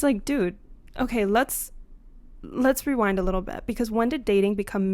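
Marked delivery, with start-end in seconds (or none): none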